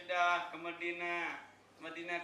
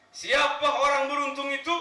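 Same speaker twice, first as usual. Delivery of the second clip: high, booming question; loud rhetorical call; 9 semitones higher, 14 dB louder than usual. Real, not acted. Preacher rousing the congregation, loud high call.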